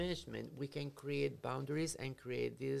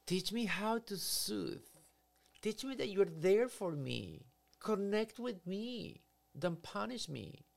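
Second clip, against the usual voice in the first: slow, higher pleading plea; 6 semitones higher, slower than usual. Retold or imitated. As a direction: beg the parent slowly for comfort. Child's brain pleading with parent.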